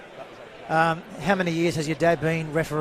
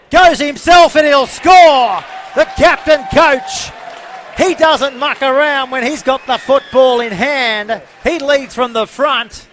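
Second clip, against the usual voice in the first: excited try call; 8 semitones higher, 10 dB louder than usual. Real, not acted. Live call of a try scored.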